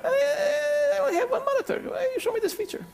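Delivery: very high, squeaky put-on Borat-style accent; mock Borat accent. Speaker retrying the Bulgarian complaint in a Borat accent. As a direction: whine it like a bad Borat impression. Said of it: "that was Borat?".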